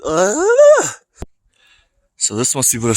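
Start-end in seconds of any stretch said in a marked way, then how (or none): 0.0-0.9 sudden high sharp yelp; startled yelp. Real, not acted. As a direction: cry out in a flash of fright.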